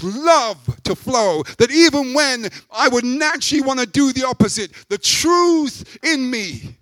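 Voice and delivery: loud, high, impassioned sermon; preaching. Acted. Preacher urging the church, loud and high. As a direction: preach it with full force to the congregation.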